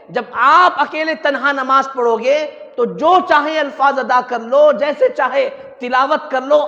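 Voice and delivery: loud, driving sermon warning; loud, emphatic preacher; full-force preaching. Real, not acted. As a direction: challenge the congregation with force.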